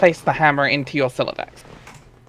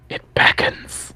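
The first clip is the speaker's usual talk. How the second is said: slow tempting whisper; hushed whisper; much slower than usual. A narrator's reading. Narrator whispering how the desk tempts John.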